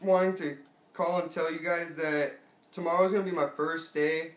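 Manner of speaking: ordinary delivery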